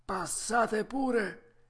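voce acuta e lenta del falegname; la voce strascicata e più alta di un vecchio falegname; falegname spaventato. Acted. (voce acuta da falegname)

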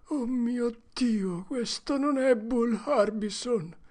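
put-on high, slow terrified boy; frightened whisper. Huck whispers to Tom in terror, high and slow. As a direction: draw the words out in horror. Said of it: "sussurrò Huckleberry".

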